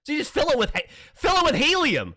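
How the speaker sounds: exasperated. Real, not acted. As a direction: insist loudly, exasperated at being misunderstood. Exasperated arguing over the balloon.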